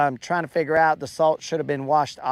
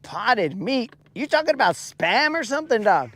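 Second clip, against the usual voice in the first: incredulous; 7 semitones higher than usual. Real, not acted. Incredulous reaction to comment.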